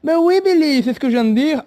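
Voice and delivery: high, loud put-on host voice; exasperated host. Host answering confused Billy, high-pitched and exasperated.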